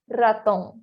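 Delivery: "raton" opening with a trill; rolled initial R. Shown as a demonstration. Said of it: "R inicial também vai ser o vibrante".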